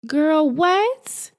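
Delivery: high, loud mock disbelief; a sassy, stunned "Girl, what?"; incredulous girl what.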